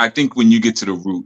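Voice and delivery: ordinary talk, nothing marked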